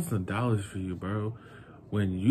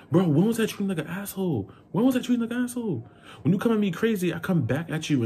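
fast, high-pitched disbelief; rapid outraged questioning, retold; indignant protest; 10 semitones higher, faster than usual. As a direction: shoot the accusation back, voice way up. Acted protest at partner's accusation.

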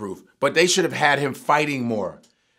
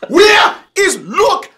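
much higher, loud, slow mock Jamaican accent shouting; 12 semitones higher, 10 dB louder, slower than usual. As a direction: ham up a Jamaican accent, calling out. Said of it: "doing different Jamaican accent".

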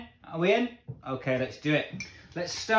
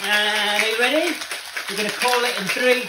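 raised, energetic call to start; loud countdown; 6 semitones higher, 6 dB louder than usual. Real, not acted. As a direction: pump up the viewer. Second shake countdown.

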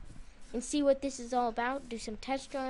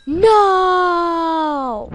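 loud drawn-out despairing no; long wailing no; 5 semitones higher, 11 dB louder than usual. Real, not acted. Player watching items vanish, a long loud no. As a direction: stretch the no in dismay. Stuff disappears.

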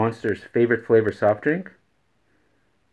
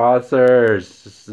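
Tyrannosaurs slow and louder; stressed correction; 3 semitones higher, 9 dB louder than usual. Shown as a demonstration. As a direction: spell out Tyrannosaurs, slow and firm. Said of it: "not thesaurus".

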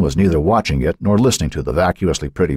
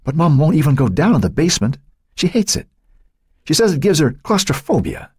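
put-on teenage boy; 11 semitones higher than usual. A narrator's reading. Matt reasons about his mother.

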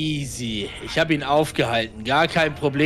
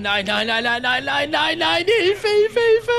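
being chased; 10 semitones higher, slower than usual. Real, not acted. Killer chasing him in game.

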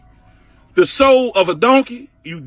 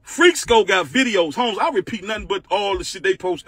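ordinary talk

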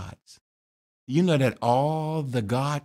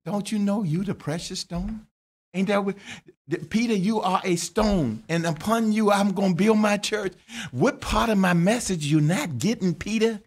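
high, chiding voice of Jesus; Jesus to Peter; 9 semitones higher than usual. Acted. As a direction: scold Peter for missing the point. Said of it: "Jesus just looked at him".

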